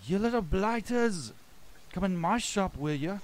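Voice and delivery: high, loud yell of an indignant shopkeeper; angry yell. Acted. Shopkeeper driving rats out of her shop, angry yell. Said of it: "as she yells".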